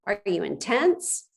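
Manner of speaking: ordinary delivery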